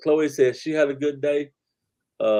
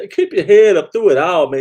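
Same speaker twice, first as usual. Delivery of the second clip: loud encouragement; 5 semitones higher, 10 dB louder than usual. Real, not acted. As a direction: call it out to lift them up. Encouraging viewers, calling out.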